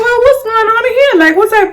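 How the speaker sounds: fake-sweet greeting to the girls she dislikes; high mock greeting. Speaking to rival girls at the party, high fake-sweet greeting.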